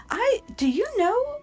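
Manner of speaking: very high, squeaky frog voice; anxious gossiping frog. Acted. Frog asks whether it's bound to Titania, squeaky gossip. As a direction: squeak the nosy question way up high.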